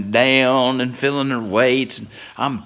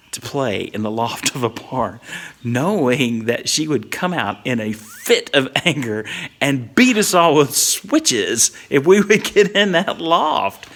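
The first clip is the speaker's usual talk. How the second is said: normal talking voice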